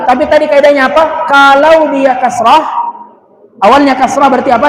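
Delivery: usual speaking voice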